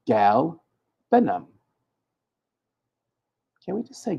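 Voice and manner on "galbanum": "Galbanum" very slowly, syllable by syllable; slowly sounded out. Shown as a demonstration. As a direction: drag "Galbanum" out carefully. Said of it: "Oh, I can".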